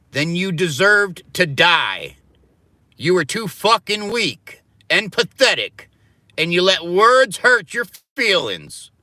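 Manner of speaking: slow, loud scolding, high and biting; harsh angry rant. Real, not acted. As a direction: hammer it home with scorn. Harsh rant against sensitivity.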